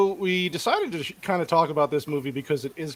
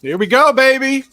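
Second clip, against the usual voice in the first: loud, higher hype call; rally the room to start; hyped; 9 semitones higher, 12 dB louder than usual. Real, not acted. Host kicking off the review, hyped up.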